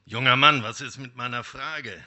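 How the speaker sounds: the wolf calls out to him; wolf calling. Acted. Wolf calls to the young man leaving the forest, loud. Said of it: "rief der Wolf ihm zu".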